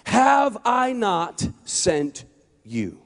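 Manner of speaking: very slow, spaced-out question; solemn commission. Acted. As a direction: set each word apart with weighty pauses. God's solemn commission to Gideon.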